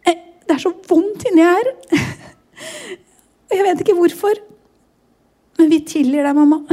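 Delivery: quoting her daughter through real tears; tearful. Real, not acted. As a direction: struggle to get the words out crying. Quoting daughter while crying. (gråtkvalt sitat av datteren)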